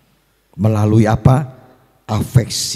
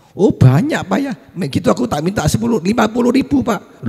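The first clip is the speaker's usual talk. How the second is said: high, loud excited pleading; acted childlike eagerness, pitched way up; eager child; 5 dB louder than usual. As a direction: play an impressed child asking for money. Child pleads with father for money.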